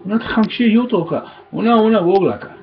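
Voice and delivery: high, quick, brotherly address; talking to little sister. Retold or imitated. Brother greeting his little sister, high and quick. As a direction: call out to a little sister in Lakota, bright and quick. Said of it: "if I'm talking to my little sister".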